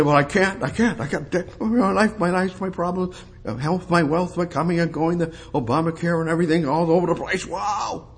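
high-pitched, rushed list of woes; mock complaining. Preacher mimicking a believer listing problems, mock panicked whine.

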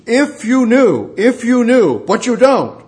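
high, loud preaching cry; impassioned cry. Real, not acted. Preacher crying out to the unknowing city, impassioned. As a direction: cry it out from the pulpit, stretched and urgent. Preacher cries out over unbelief.

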